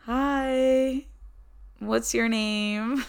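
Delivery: shy flirty hi. Retold or imitated. Approaching gym crush, high voice.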